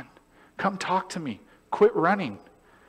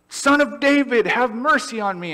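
crying out; 9 semitones higher, 6 dB louder than usual. Retold or imitated. Blind man crying out to Jesus for mercy, loud. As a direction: call out for mercy across a crowd. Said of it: "the blind man who is crying out".

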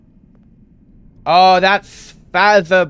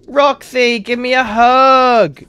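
mock sweet taunt; 8 semitones higher, 4 dB louder, slower than usual. Mock taunt at stuck enemy.

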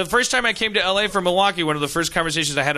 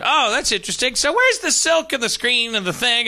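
higher, keenly curious questioning; mock eager interest; 7 semitones higher than usual. Newcomer quizzing a stranger about silk screening, eagerly interested. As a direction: play up polite fascination.

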